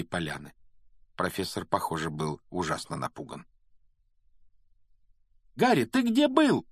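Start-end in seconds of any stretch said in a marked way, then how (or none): 5.6-6.7 very high, loud squeal. A narrator's reading. Hermione waiting for Harry at the castle entrance, squealing. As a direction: shriek the question in excitement. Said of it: "завизжала Гермиона вместе с Роном".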